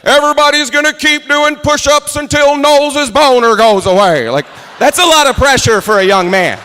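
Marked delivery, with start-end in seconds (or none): 0.0-4.3 drill instructor bark. Retold or imitated. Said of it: "He's just marching around like".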